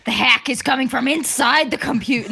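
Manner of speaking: mock-panicked caller; mock horror warning. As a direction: deliver it as a parody thriller reveal. Parodies horror-movie line.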